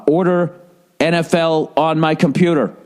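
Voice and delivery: fake-pleasant, spelled out for a machine; forced pleasantness. Caller answering the robot, keeping it pleasant. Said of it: "I just kept going pleasantly".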